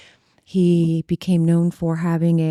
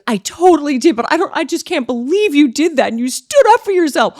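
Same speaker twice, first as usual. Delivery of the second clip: high, louder, gushing voice; imitated excited young girl; 8 semitones higher, 6 dB louder than usual. Young girl admiring her for standing up to him, high excited gushing. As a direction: gush in amazed admiration. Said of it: "that young girl was like".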